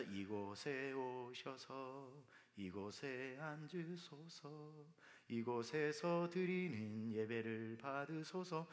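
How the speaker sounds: soft, slow reverent praying; a gentle murmured plea to God; hushed slow prayer. Retold or imitated. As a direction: pray it quietly, drawn out. Retold prayer on the land.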